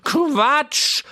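high, loud horsey scoff; whinny the word out; neighing Quatsch. A narrator's reading. Horse Troubadour dismissing the boy with a high, loud whinny. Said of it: "wieherte Troubadour".

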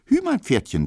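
squealed high and loud like a small boy playing horse; gleeful child shouting giddy-up; jubilant child. A narrator's reading. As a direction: cheer your pretend pony on, child's glee.

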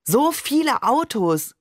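high, drawn-out exclamation; amazed call. A narrator's reading. Narrator as child marvels at the traffic, amazed call. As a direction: call it out high and slow in wonder. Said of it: "rief ich".

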